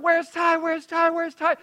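put-on high, loud kids calling a name; repeated high calls of kids hunting for a hidden cousin. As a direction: call around the house searching for Ty.

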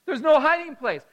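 a child's rising panic, voiced high and loud; nervous kid. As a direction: fret like a scared kid about to be caught. Child panicking in hide and seek.

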